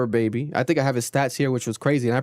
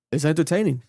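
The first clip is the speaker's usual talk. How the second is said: ordinary talk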